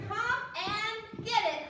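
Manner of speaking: Froggy calling his parents to the table; hollering. Acted. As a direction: holler to the family to come eat. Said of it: "hollered Froggy".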